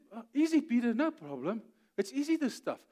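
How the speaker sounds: high, breezy reassurance; easygoing Jesus. Retold or imitated. Jesus telling Peter walking on water is easy, breezy. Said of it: "And he said".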